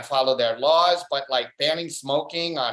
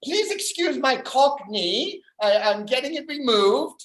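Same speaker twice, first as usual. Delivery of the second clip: high-pitched, slowed-down mock apology; apologetic Cockney speaker; 8 semitones higher than usual. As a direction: play a Cockney excusing an affliction. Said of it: "Cockney accent sounds like an unfortunate deformity".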